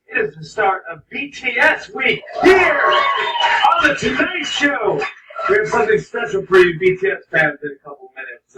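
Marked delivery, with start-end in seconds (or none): 0.1-7.1 high, drawn-out announcer shout; excited announcement. Real, not acted. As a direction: hype the crowd, stretching the last words. Host hypes the show opening.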